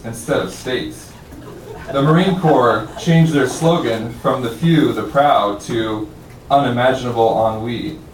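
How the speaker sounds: projected, mock-formal proclamation; emphatic recital with pauses for the joke; poem reading. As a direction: read a satirical poem like a proposal to the room. Reads satirical poem aloud.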